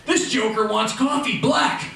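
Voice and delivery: frantic shout to a coworker; panicked yell. Acted. Barista co-worker yelling for help.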